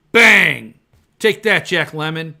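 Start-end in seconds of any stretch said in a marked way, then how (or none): 0.1-0.7 loud sharp bang sound; mimicking Baldwin shooting you, loud; mock gunshot. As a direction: shout a gunshot noise. Gunshot imitation.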